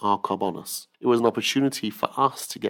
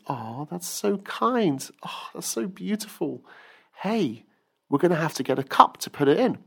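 doting mother; 7 semitones higher than usual. Acted. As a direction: coo over a child's gift. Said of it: "And his mother goes".

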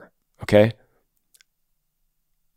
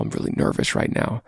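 put-on whispered, shy confession to a girl; imitating a nervous boy whispering to a girl he likes; 13 semitones lower, 9 dB quieter than usual. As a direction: hush it like you're scared to say it. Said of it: "being as vulnerable as possible".

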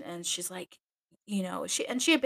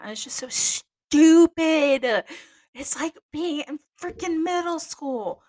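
higher, slow exasperated whine; 9 semitones higher, 8 dB louder, slower than usual. Real, not acted. Nun venting about petty convent life, exasperated. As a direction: drag out the disbelief. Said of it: "exasperated about stupidity".

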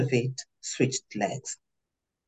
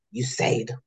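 ordinary delivery

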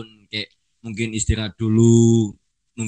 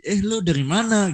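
Jakarta slang; 8 semitones higher than usual. Retold or imitated. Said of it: "kalau denger sama temen sendiri".

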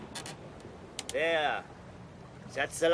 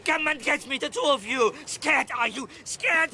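high, shouted goading; wild jeering challenge; defiant taunting; 8 semitones higher than usual. Acted. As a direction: dare them to attack. Taunting the two attackers.

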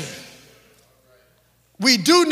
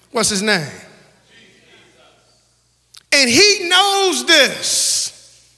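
higher, louder, stretched preacher's cry; full-force preaching; 4 semitones higher, 5 dB louder than usual. Real, not acted. Preacher crying out to the church about his mission. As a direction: lift it up like a shout in church.

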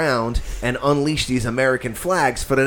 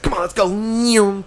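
mock coaxing; 9 semitones higher, slower than usual. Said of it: "He's like".

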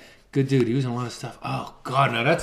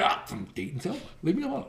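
meek reply; 3 semitones higher, 10 dB quieter, faster than usual. Pretend. Acting himself answering the challenger.

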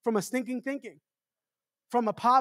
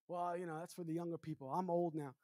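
much softer, weary mock voice; mock old excuse; 5 semitones lower, 15 dB quieter than usual. Preacher mimicking a congregant's excuse, softly and wearily. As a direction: mimic a tired excuse, barely audible.

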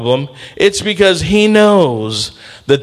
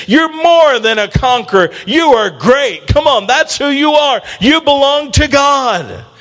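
building exhortation, climbing higher and higher; rousing preaching; 5 semitones higher than usual. Real, not acted. Preacher exhorts congregation, building to climax.

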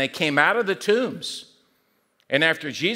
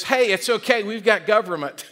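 higher-pitched mock reassurance; breezy reassurance; 6 semitones higher, 3 dB louder than usual. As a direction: brush the worry off in a higher voice. Voices the socialist answer.

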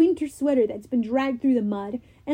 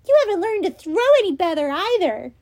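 shouting taunt; 7 semitones higher, 6 dB louder, faster than usual. Acted. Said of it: "Annabeth shouted".

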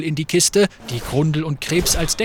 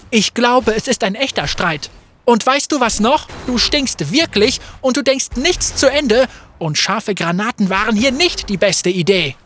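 higher, louder tirade; angry outburst; 5 semitones higher, 4 dB louder than usual. Acted. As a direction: let it all out. Fate escalating the fight.

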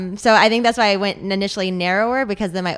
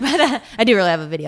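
laughing; 4 dB louder, faster than usual. Real, not acted. Laughing after her joke.

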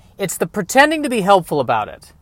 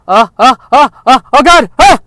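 loud, higher strained panicked groans; hammed-up panic of a bursting bladder; mock bladder panic; 9 semitones higher, 13 dB louder than usual. Host fakes a bladder emergency for the sponsor: loud strained groans.

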